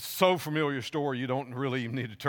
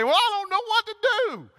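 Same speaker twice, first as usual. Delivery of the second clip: much higher, louder frantic cry; panicked Peter; 5 dB louder than usual. Retold or imitated. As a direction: flail like a man who has lost his head. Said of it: "I can just hear Peter say".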